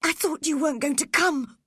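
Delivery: high, quick and anxious; frightened boy, rushing his words. A narrator's reading. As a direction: blurt it out as a scared boy. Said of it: "He was looking very frightened".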